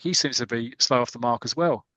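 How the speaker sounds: ordinary talk, nothing marked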